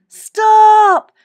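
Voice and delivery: high, loud drawn-out shout; desperate yell, held long; alarmed cry. A narrator's reading. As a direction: scream it to halt everyone. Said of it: "cried Louis".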